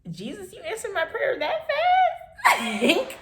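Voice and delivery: high, slow, laughing through tears; cry-laugh it out in grateful disbelief; laughing, tearful joy. Retold or imitated. Overjoyed reaction to answered prayer.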